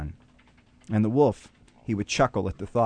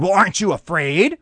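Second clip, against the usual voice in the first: raised, louder wolf voice, baffled; indignant wolf; 8 semitones higher, 6 dB louder than usual. Acted. Wolf challenging the unafraid sheep, loud and indignant. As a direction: puff up and challenge the calm sheep.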